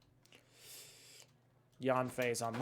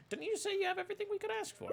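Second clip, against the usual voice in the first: mocking high voice; 4 dB quieter, faster than usual. Mocking the fishmonger.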